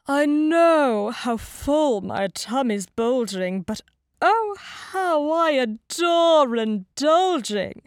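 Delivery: big, drawn-out wolf voice; indulgent greedy wolf. A narrator's reading. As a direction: revel in gluttony, loud and theatrical. Wolf relishes his gluttony.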